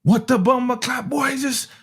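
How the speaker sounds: put-on high, irate Caribbean accent. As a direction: yell it in an angry Caribbean accent. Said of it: "he got a Caribbean accent; he gets irate".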